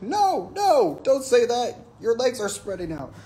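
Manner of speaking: high, loud goofy protest; dumb buddy voice. Retold or imitated. Mark teasing from the zip line, high and loud. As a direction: tease like a dopey jock. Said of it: "And he's like".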